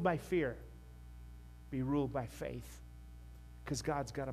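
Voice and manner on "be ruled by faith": ordinary talk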